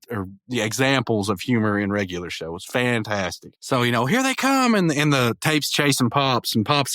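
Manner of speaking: ordinary talk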